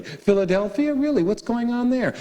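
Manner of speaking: rising, amazed questions; mock surprise. Asking Jack about Philly, amazed. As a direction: play it like you can't believe it.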